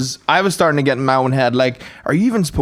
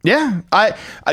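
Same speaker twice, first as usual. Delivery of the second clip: usual speaking voice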